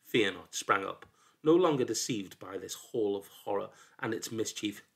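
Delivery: usual speaking voice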